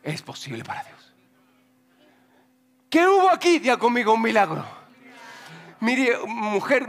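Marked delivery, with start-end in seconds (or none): 0.0-1.1 sudden soft whisper; whispered reveal. Real, not acted. Preacher tells the congregation, dramatic whisper. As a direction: drop to a hush for the punchline.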